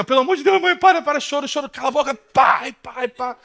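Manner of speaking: high, loud imitation of a kid pleading; mock desperate begging. Boy begging his mother to stop the beating, high mock panic. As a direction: cry out like a child being beaten, half joking.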